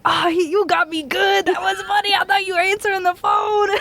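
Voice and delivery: high, laughing through the words; imitating a grown man falling out laughing; laughing sergeant. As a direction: talk while cracking up. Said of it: "falling out laughing and be like".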